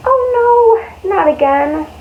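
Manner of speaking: high yelp of dismay. A narrator's reading. Teacher reading story, high dismayed cry. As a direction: react to the spilled lunch. Dropped lunch on loafers.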